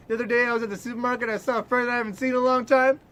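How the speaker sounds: usual speaking voice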